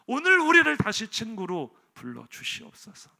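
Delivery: slow earnest pleading prayer that swells to loud cries; fervent prayer. Acted. Moses praying for Israel, slow, fervent and rising. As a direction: beg God to restore the bond, slowly.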